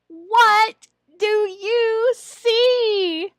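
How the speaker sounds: high, slow, loud teacher question. Shown as a demonstration. Trainer models the target phrase, slow and loud. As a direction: draw the phrase out for a young learner. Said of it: "If it's".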